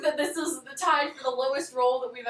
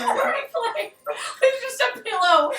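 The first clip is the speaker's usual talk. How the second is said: laughing; 9 semitones higher, 5 dB louder than usual. Real, not acted. Watching replay laughing.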